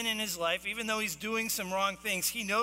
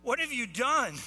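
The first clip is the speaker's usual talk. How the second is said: acted yell, higher and louder; screamed demand; 6 semitones higher, 5 dB louder, slower than usual. Sailors interrogating Jonah on the bow of the ship, screaming. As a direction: shout it as if over a raging storm. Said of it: "They're screaming at the top of their lungs".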